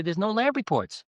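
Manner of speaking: ordinary talk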